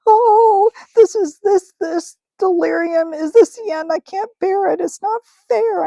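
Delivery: a terrified uncle pleading his innocence; high, wailing complaint. Acted. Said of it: "was a wailing".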